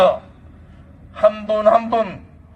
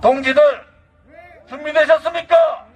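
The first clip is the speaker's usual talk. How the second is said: rallying call; 6 semitones higher than usual. Acted. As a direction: call the crowd to readiness.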